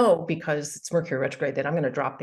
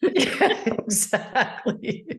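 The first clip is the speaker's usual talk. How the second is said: breathy laugh through words; 7 semitones higher, much slower than usual. Real, not acted. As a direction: laugh as you say it. Laughing at readers joke.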